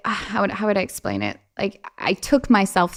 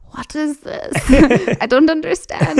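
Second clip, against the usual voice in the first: put-on higher, laughing confused kid voice; 7 semitones higher, 5 dB louder than usual. Young daughter in the minivan, higher and baffled. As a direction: play a baffled twelve-year-old. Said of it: "I'm just like".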